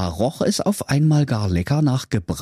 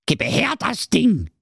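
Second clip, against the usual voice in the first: high-pitched, wheedling witch demand; cunning old witch; 10 semitones higher than usual. A narrator's reading. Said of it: "sprach listig". (listige alte Hexe, hohe Stimme)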